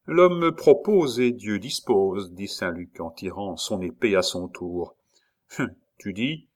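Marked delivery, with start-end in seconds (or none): 0.1-2.3 sentencieux. Acted. Sentence calme avant le duel.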